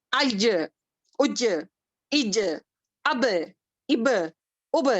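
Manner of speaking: teacher sounds out aj and ab slowly; demo letter sound. Shown as a demonstration. Teacher drilling letter sounds for students. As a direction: model the letter sounds for the class. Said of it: "جیسے کہ".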